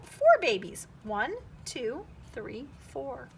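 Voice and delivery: ordinary delivery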